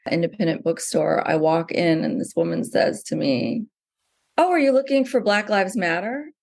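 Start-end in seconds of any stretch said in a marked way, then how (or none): 4.4-6.3 high-pitched question with a rising end; imitated store greeter. Bookseller greeting a customer who walks in, imitated high-pitched question. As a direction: mimic her high, lilting query. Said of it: "that's how she said it".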